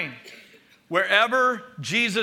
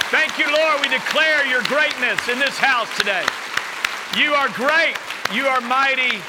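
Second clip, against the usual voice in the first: louder, higher, slow proclaiming of God's names; loud group declaration; 4 semitones higher, 4 dB louder, slower than usual. Real, not acted. Pastor leading church in standing declaration, loud and slow. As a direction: proclaim each name out loud with the congregation. Congregation declaration, preaching at full force.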